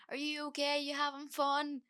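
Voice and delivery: high, sing-song, cheery; bubbly tipsy check-in. Retold or imitated. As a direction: chirp it like you're buzzing on a night out.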